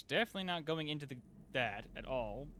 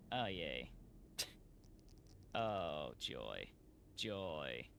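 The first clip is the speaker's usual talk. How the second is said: much lower, softer deadpan "joy"; sarcastic; 10 semitones lower, 8 dB quieter, much slower than usual. Real, not acted. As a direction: drop the voice in dry sarcasm. Sarcastic after another snag.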